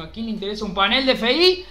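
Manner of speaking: ordinary talk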